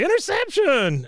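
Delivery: high, drawn-out stadium announcer yell; announcer call. Retold or imitated. Podcaster retells the announcer's call: high stretched yell. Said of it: "the announcer say".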